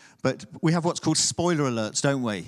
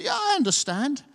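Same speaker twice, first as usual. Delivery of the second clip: mock know-it-all, pitched much higher; eager confident reply; 10 semitones higher than usual. Preacher imitating a wise listener who understands everything. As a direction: play someone who claims to get it all. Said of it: "I'm expecting somebody to say".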